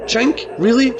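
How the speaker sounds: high, loud disbelieving echo of the name; incredulous retort. Acted. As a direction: throw the name back in disbelief. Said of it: "she growled".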